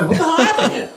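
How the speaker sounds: very high-pitched laughing disbelief; mock bewilderment. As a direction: squeal it in comic shock, laughing through it. Acting out his own astonished reaction.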